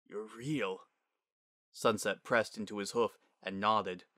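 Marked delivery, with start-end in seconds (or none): 0.1-0.9 stunned. Acted. Father stunned by daughter's return.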